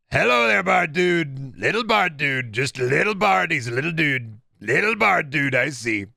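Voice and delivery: hammed-up politician voice; Donald Trump. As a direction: slip into a swaggering politician parody. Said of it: "it sounded like you were slipping into a trunk, Trump".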